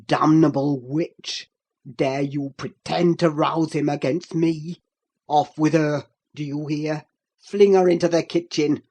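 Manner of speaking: low, loud fury; raging Heathcliff. A narrator's reading. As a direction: Heathcliff orders Catherine thrown out, low and loud. Heathcliff rages at Catherine.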